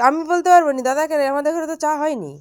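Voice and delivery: loud, high call across the house. Real, not acted. Calling out to dada across the house, loud and high. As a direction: shout it so dada hears from afar.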